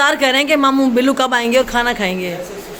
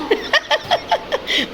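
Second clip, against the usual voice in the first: breathy high laugh; 4 dB quieter than usual. Real, not acted. Laughing at daughter's cake.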